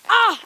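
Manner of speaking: loud short cry of pain; pain yelp. Real, not acted. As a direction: yelp from the sting. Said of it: "I stood on a thistle".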